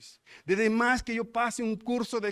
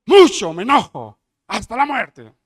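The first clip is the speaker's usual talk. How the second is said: furious shout; 3 semitones higher, 13 dB louder than usual. Acted. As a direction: roar it out much louder, voice strained. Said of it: "¿Cómo hizo?". (grito furioso de Jonás)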